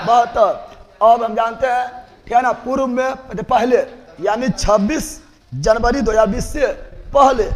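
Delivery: usual speaking voice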